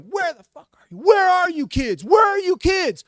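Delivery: high, loud yell, building in force; enraged shouting. Retold or imitated. Man on the roof hunting the kids, angry shout. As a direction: bellow for them as if from a rooftop.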